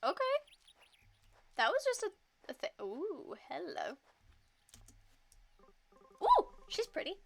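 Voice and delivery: high, loud, delighted; excited. Real, not acted. Streamer reacting to a pretty character, high and loud. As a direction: gush over her.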